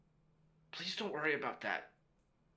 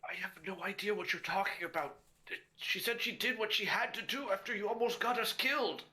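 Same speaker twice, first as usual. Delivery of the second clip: high, urgent protest, getting louder; distressed son; 8 semitones higher, 5 dB louder, faster than usual. Acted. Fallen Oski answers his father, high distressed protest. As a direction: cry back in panic, pitch climbing.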